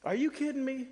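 high, indignant disbelief; a king who can't believe his ears; enraged king. Retold or imitated. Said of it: "the king is a bit enraged".

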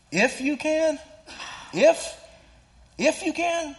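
high, loud, disbelieving repeat; incredulous high "If you can?"; incredulous exclamation. Shown as a demonstration. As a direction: echo the words back in sharp disbelief. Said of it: "He just says it once, but there's an exclamation point".

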